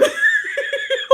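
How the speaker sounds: high squealing laughing fit; uncontrolled laughter. Real, not acted. Host failing to explain, helpless high laugh. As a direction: crack up completely. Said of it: "the reason I'm laughing".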